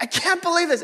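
hammed-up furious line; mock outraged. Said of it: "And I'm like".

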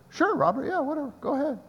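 high, offhand whatever; casual. Retold or imitated. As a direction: toss it off lightly. Casual reply retold.

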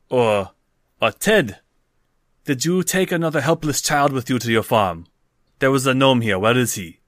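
loud, accusing barkeep; tavern keeper. Acted. Barkeep asking farmers about the missing gnome, accusing.

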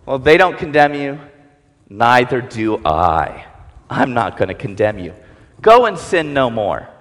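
tender pardon, almost hushed; gentle Jesus. Acted. Jesus speaking gently to the caught woman, low and tender.

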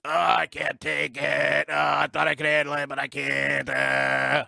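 strained, pleading victim cry; whiny burn victim. Retold or imitated. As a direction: beg like you're being roasted raw. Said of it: "and the guy's".

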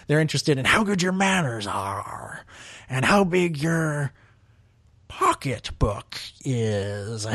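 a deliberately bad, hammy alien cook voice; Dexter Jettster voice. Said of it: "That's my terrible, uh, Dexter Jex-, Jester impression".

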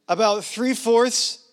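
a hint of George Bush's Texan twang; George Bush accent. Real, not acted. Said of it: "say it with a George Bush accent".

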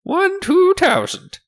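very high, loud merchant voice; haughty shopkeeper. Acted. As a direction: squeal the price out loudly. Shopkeeper haggling, names price.

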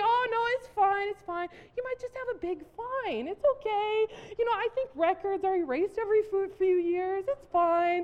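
high, giggly mock comfort; friend teasing, laughing. Friend laughs while assuring her the missed summons is fine. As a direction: laugh through the fake reassurance. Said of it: "He's like".

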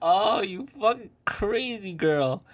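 high, loud, slow laughing jeer; laughing disbelief. Real, not acted. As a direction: laugh it out at her.

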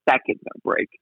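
unvoiced whisper of dread; whispered worry. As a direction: whisper it like a secret fear. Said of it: "and I was like".

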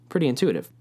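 ordinary talk, nothing marked

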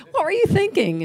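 high-pitched mock scolding; hammed-up exasperation; exasperated outburst. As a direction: toss it at him in hammed-up disbelief. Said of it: "I'm like".

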